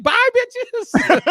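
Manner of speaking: high, loud sassy farewell; cheeky triumphant squeal. Retold or imitated. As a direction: squeal it like a victory. Gloating exit after winning case.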